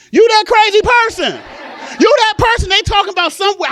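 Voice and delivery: high, loud comic accusation; accusing shout. Pretend. Comic to audience members not nodding, accusing shout.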